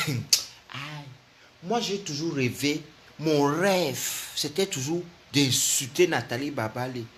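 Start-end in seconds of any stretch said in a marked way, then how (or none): none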